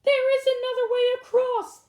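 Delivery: high, loud excited cry; cried out. Acted. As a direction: shout the discovery up high. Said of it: "cried Blackberry".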